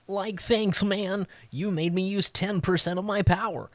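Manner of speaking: high-pitched, badly faked cartoon voice; bad Shaggy impersonation. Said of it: "That was the worst Shaggy".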